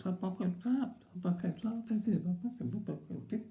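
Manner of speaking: high, mushy toothless chant; mock the gummy husband repeating himself; "bucket of crap" mumbled high with no teeth; toothless mumble. Toothless husband says "saps.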